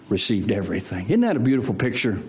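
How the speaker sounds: normal talking voice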